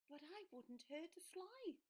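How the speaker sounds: a mild creature pleading softly; gentle dragon. Acted. Dragon tells the boy he'd harm no one, softly. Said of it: "said the dragon".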